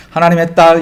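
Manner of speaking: put-on child's proud answer. Daughter's proud answer, acted.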